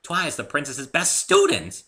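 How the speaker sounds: high, loud excited pony voice; bubbly Pinkie explaining; eager high chatter. A narrator's reading. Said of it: "said Pinkie".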